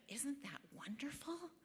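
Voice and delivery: breathy high hushed gush, imitating the leader; gushing whisper. Campus leader delighted by her answer, mock gushing whisper. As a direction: whisper it as if thrilled at a convert.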